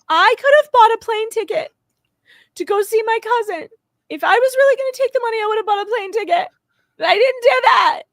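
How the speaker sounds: high, loud mock wail of protest; imitating someone wailing in self-defense; Felicia's signature crying. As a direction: go for broke on a hammed-up tearful outburst. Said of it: "Felicia does her signature crying".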